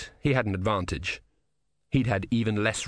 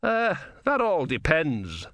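slow, hesitant, higher-pitched reply; cautious; 6 semitones higher, slower than usual. A narrator's reading. Philosopher answering a soldier's password, slow cautious reply. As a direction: hedge it slowly, drawing words out. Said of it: "said the philosopher cautiously".

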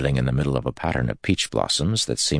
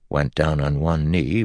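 ordinary delivery

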